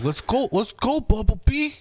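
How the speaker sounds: high-pitched, excited actor impersonation; hammed-up movie-hero voice, high and rising; Mark Wahlberg. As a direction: pitch it way up, calling out urgently. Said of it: "That's my Mark Wahlberg impersonation".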